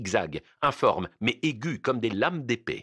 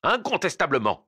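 raised, higher-pitched furious retort; angry yell; 7 semitones higher, 4 dB louder than usual. A narrator's reading. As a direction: hurl it back at him. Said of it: "hurla le juge". (hurlement de colère, aigu)

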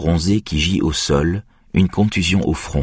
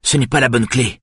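raised, urgent and higher; frustrated at the wrong key, raised voice; loud voice; 6 semitones higher than usual. Acted. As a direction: call it out over the storm. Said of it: "dit Björn d'une voix forte".

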